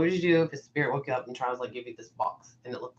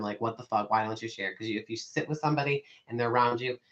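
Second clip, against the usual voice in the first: ordinary delivery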